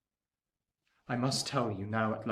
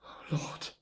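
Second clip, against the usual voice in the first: breathed hushed prayer; whispered prayer; 6 dB quieter than usual. Acted. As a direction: barely voice the plea. Prayer at deathbed.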